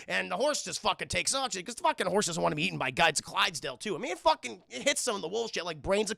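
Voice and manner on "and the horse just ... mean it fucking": ordinary talk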